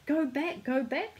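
crying out. A narrator's reading. Philosopher warning the little man, crying out. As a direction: urge him to turn round. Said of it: "cried the philosopher".

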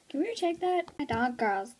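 ordinary delivery

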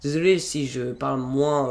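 ordinary talk, nothing marked

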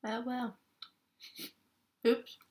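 talking while chewing food; muffled by food in mouth; mouth full, chewing. Real, not acted. Seen 2: Holds bitten burrito, lips closed and working between short phrases.

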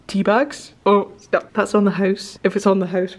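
ordinary talk, nothing marked

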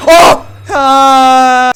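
sudden loud yell; strained yell. Real, not acted. Streamer yelling at the game, cracking. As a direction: cry out in a cracking voice.

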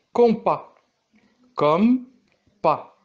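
Compas said high and loud, then comme pas; syllable demo. Shown as a demonstration. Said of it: "nous parlons du découpage syllabique".

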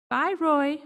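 put-on high cheerful child call; child calling bye. A narrator's reading. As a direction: wave goodbye like a kid. Child calls to friend.

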